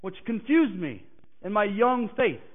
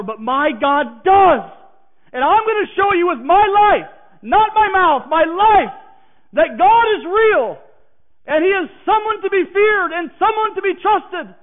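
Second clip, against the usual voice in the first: fervent declaration; 6 semitones higher, 7 dB louder than usual. Acted. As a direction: proclaim it with conviction. Preacher answers with conviction.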